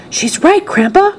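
put-on high, excited boy's cry; Charlie. A narrator's reading. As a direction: call out to grandpa in amazement. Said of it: "cried Charlie".

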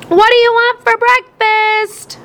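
high, slow call across a noisy room; shouted over the TV. A narrator's reading. Mom calls to Dad over the TV, shouting. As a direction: project it over the blaring TV. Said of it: "Mom shouted".

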